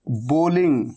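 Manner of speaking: बोलिंग said low, with a long o; correct pronunciation. Shown as a demonstration. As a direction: reveal बोलिंग carefully. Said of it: "बॉलिंग नहीं, क्या?".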